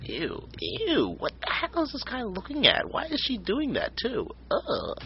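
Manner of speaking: high, soft disgusted disbelief. Real, not acted. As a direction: recoil in disgust, quietly. Disgusted by video.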